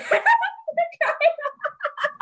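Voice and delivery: high, loud laugh bursting out; real laugh, high-pitched and loud; burst of laughter. Real, not acted. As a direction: crack up after the quote. Laughing at the quoted line.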